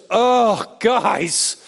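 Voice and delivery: a hint of fed-up disbelief; exasperated. Jesus mock-scolding slow apostles, exasperated. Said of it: "he never just goes".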